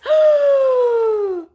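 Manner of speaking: long, very high, loud gasp of delight; a gasp as the wrapping comes off; big gasp. Real, not acted. Seller unwrapping a gift from Dolores, a long high loud gasp.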